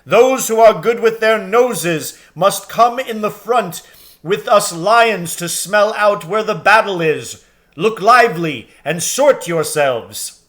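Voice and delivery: big, loud lion king rallying the troops; Aslan's commanding voice. A narrator's reading. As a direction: boom it out like a great lion calling his army. Aslan commands his army.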